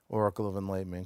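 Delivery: ordinary talk, nothing marked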